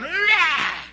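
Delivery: high imitated preacher's cry; mock a shouting evangelist's whoop; hammed-up revival yell. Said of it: "llegaban y gritaban".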